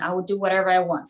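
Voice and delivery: usual speaking voice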